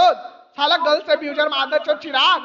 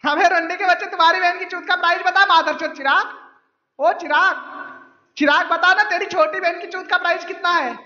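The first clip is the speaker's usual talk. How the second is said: ordinary talk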